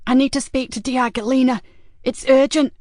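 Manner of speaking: strange, insistent woman on the line; odd-sounding woman caller. A narrator's reading. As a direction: press the plea urgently in an odd woman's voice. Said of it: "sounded to Joseph as being distinctly odd".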